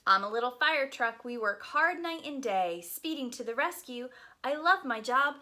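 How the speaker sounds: lively, sing-song picture book reading; reading to children. Acted. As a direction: read it aloud to a group of little kids.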